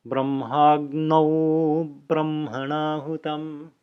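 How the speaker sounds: Brahmagno then brahmanahutam, slow and separated; Sanskrit word by word. Shown as a demonstration. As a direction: split Brahmagno brahmanahutam into two slow words. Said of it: "The O is right there".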